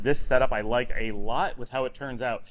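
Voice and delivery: ordinary delivery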